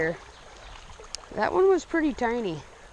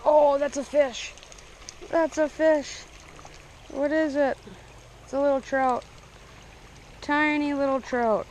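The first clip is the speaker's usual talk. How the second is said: higher-pitched, eager reaction; excited discovery; 5 semitones higher than usual. Real, not acted. Angler reels in a little trout, excited. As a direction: lift the pitch, thrilled by a bite. Another fish on the line.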